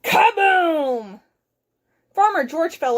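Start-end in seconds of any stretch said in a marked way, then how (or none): 0.0-1.2 high, falling explosive boom; booming crash effect. Acted. Flying saucer crashes on the farm, booming.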